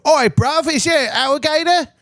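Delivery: high, loud Aussie-Cockney muddle; hammed-up Australian accent, way up high; mock Australian accent. Aussie spotting the car gator, hammed-up Australian accent. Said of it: "It's a perfect Australian accent".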